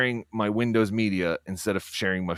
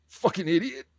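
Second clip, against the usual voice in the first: a higher, quick insult in the same put-on voice; high impression insult; 12 semitones higher than usual. Still playing the furious Carl voice, higher. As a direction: toss it off still in character. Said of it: "I can't do loud impressions right now".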